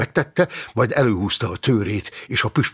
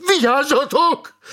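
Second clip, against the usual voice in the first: very high, loud, stretched yell; screamed warning; 5 dB louder than usual. A narrator's reading. Helpless notary warning the fighters, screamed warning. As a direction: scream the alarm to everyone. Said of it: "ordította a jegyző".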